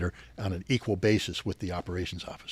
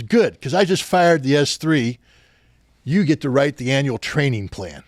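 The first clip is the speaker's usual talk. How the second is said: retold colonel order, louder, higher and slow; imitating a barking colonel; 3 semitones higher, 6 dB louder than usual. Veteran voicing his colonel assigning him the plan, loud. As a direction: boom it out like a commander handing down orders.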